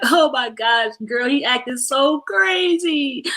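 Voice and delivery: stretched-out fake sobby complaint; crying on the phone. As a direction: whine it out slowly to a girlfriend. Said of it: "If you're crying".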